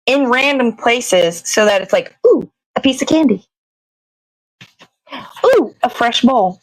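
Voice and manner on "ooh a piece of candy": ordinary talk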